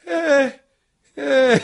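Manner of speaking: high, squeaky appalled eh; disgusted grunt. Retold or imitated. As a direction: squeak out a grossed-out eh. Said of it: "the two makeup girls are like".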